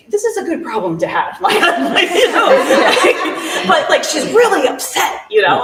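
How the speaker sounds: high laughing, barely getting words out; real laughter at a funny irony. Real, not acted. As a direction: laugh through the whole line. Laughing retelling friend's dilemma.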